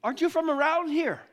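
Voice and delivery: can't believe he hasn't heard; incredulous disciples. Acted. Disciples ask the stranger in astonished disbelief. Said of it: "they looked at him".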